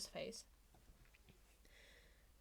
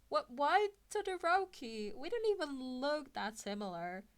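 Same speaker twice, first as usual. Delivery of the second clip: flustered surprise; 5 semitones higher, 5 dB louder than usual. Acted. Izuku caught off guard.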